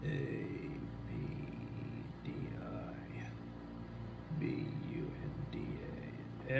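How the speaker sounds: very soft whisper, letter by letter; whispered spelling. Real, not acted. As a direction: spell it out under your breath. Spelling out a name to himself.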